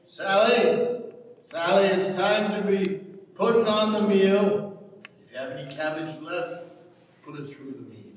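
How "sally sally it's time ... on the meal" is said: loud call across the house. Acted. Father calls Sally to start the meal, calling out loudly. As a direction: call Sally in from another room.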